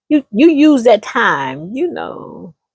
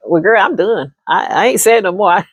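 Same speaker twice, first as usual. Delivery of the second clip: ordinary delivery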